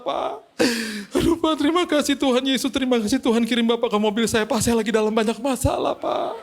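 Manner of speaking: high, tearful wail with sobbing breaths; imitated crying. Driver thanks the pastor in his car, mock crying. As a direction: act out a driver blubbering with gratitude. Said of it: "Eh, dia nangis".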